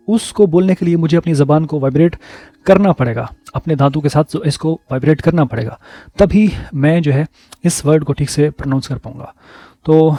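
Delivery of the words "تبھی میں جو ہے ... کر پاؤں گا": usual speaking voice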